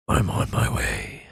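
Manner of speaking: low rumbling dragon voice; half dragon voice. Acted. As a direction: speak like a dragon answering. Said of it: "low Draconic voice".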